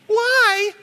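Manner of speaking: put-on high child's plaintive why; whiny kid why. A five-year-old pestering his dad at Walmart, high childish whine. Little kid voiced in story.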